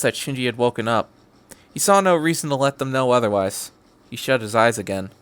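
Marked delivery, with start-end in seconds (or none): none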